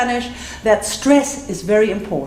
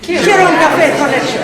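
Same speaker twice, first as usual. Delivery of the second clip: high, louder, rattled off in one breath; said fast; 7 semitones higher, 6 dB louder than usual. Shown as a demonstration. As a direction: race through it for the class to copy. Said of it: "let's say it fast".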